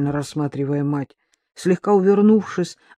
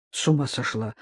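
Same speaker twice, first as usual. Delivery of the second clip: soft, low, taken aback; bewildered; 6 semitones lower, 7 dB quieter than usual. A narrator's reading. Son reacts to drunk mother, bewildered and subdued. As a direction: say it quietly, at a loss. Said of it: "растерянно сказал Павлик".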